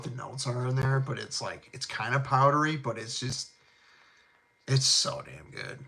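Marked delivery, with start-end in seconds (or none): none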